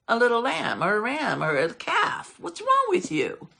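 high-pitched teasing disbelief; mocking. David mocks the Red Sea and Jordan, high and teasing. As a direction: poke fun with squeaky-high, incredulous questions. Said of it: "he makes fun and he mocks".

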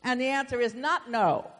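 loud, emphatic pulpit delivery; forceful preaching. Real, not acted. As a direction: preach it hard to the congregation.

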